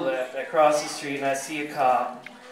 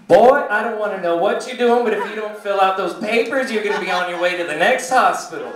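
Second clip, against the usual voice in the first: louder, higher warning; scolding desk lady; 4 semitones higher, 5 dB louder than usual. Acted. Desk lady warning a patient about his papers, scolding. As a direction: threaten him as the bossy desk lady. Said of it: "And then I hear".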